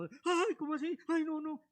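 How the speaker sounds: high, startled "ay, no, no" of a mock listener; mock shocked whine. Preacher mimics a shocked listener, mock alarm. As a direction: play the shocked sinner, half-mocking.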